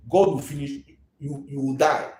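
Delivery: ordinary talk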